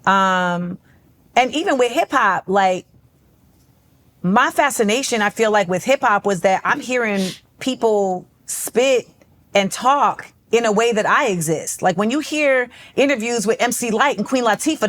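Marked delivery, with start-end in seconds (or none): none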